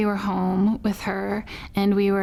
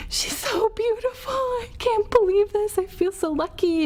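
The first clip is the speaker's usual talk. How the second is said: high, crying through the words; overwhelmed with tears of joy; tearful awe; 12 semitones higher than usual. Retold or imitated. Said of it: "weeping without even realizing it".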